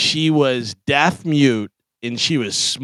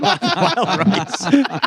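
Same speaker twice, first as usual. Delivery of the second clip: laughing; 9 semitones higher than usual. Real, not acted. Laughing while repeating catchphrase.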